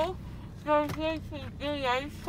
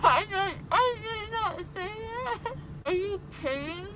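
tearful laugh; 7 semitones higher than usual. Real, not acted. Groggy patient denies what she said.

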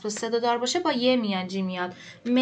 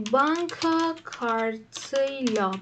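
slow dictation; much slower than usual. Shown as a demonstration. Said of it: "dictating answer slowly".

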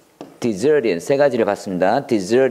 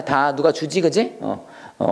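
normal talking voice

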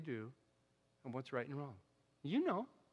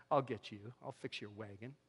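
a hushed, sly threat, much softer; subtle threat; 8 semitones lower, 11 dB quieter than usual. Retold or imitated. The subtly manipulative heart's soft, sly threat. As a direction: murmur the revenge quietly, slyly. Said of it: "some of us are just very subtly".

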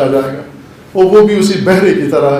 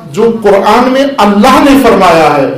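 louder, higher, ringing sermon voice; full-force preaching; 5 semitones higher, 6 dB louder than usual. Acted. Preacher warning the congregation about the Quran's question, preaching loudly. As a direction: proclaim it to the whole hall.

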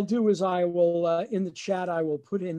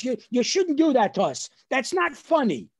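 higher, indignant telling-off; scolding mother; 7 semitones higher, 6 dB louder than usual. Retold or imitated. Mother scolding son for his prank, indignant. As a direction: imitate an offended mother, raised voice.

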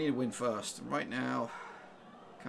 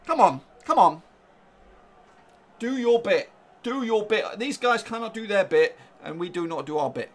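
higher, louder pleading to the team; loud urging; 5 semitones higher, 11 dB louder than usual. Real, not acted. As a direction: demand effort from the players. Exhorting team, excited.

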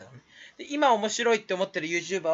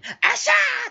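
high squealed ashaa laugh; mock silly laugh; 5 dB louder, much slower than usual. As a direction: squeal it like a goofy giggler. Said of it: "笑うじゃん". (甲高い変な笑い声のまね)

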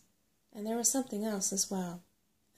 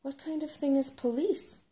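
higher-pitched, puzzled question; confused, lifting to a raised ending; baffled; 6 semitones higher, 4 dB louder than usual. A narrator's reading. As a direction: ask in open bewilderment.

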